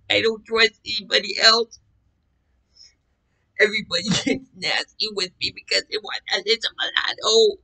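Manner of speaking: high, whining plea; distressed pleading. Retold or imitated. Begging Sissy to check for marks, distressed pleading. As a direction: plead in a high, insistent wail.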